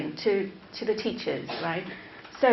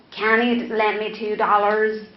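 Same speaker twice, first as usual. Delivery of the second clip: loud, cocksure mock American; imitation American, pushed louder; 6 dB louder than usual. Shown as a demonstration. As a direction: put on a brash American accent. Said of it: "Right, that's American".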